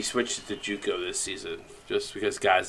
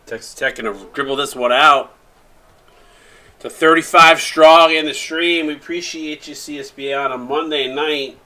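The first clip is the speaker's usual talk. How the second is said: announcer call; 6 semitones higher, 11 dB louder than usual. Acted. Game-end broadcast call.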